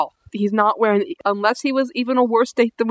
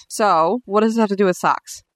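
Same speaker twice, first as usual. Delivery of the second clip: usual speaking voice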